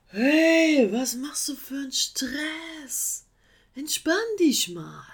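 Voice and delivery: high, lazy, drawn-out sloth voice; laid-back and unbothered, stretch it; chilled out. Acted. Sloth calling down from his tree, lazily relaxed. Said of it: "äußerst gechillt".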